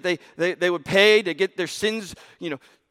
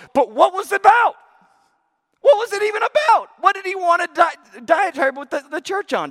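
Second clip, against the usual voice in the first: high, loud urgent questions; rising preaching; 13 semitones higher, 6 dB louder than usual. Real, not acted. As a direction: press the congregation hard. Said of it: "preacher raises voice".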